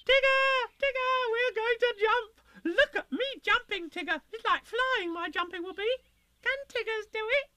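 put-on bubbling over with glee like a small child; wildly excited. A narrator's reading. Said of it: "he was wildly excited and cried out".

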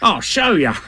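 lightly swaggering animal voice; brave Tigger. Acted. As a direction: puff it up bravely. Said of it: "said Tigger bravely".

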